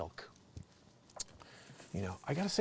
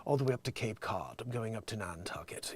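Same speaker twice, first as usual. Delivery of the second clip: low, soft Connecticut lockjaw impression; mock the Connecticut lockjaw under your breath; Connecticut lockjaw accent; 3 semitones lower, 5 dB quieter than usual. Host mocks Connecticut people, soft lockjaw accent. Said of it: "they talk like this".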